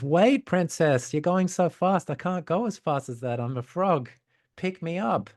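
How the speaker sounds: high-pitched storybook frog calling after someone; high, plaintive little frog voice; pleading frog. Acted. Left-behind frog calls after princess.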